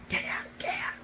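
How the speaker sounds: usual speaking voice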